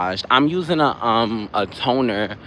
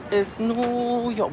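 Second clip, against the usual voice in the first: drawn out; 11 semitones higher, 4 dB quieter, much slower than usual. Pretend. Drawn-out city name.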